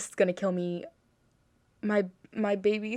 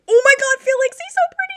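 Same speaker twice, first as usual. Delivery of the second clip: high squealing gush, much louder; fangirl squeal; 11 dB louder, faster than usual. Real, not acted. Watching Felix on screen, a fan shrieks in delight, high and loud.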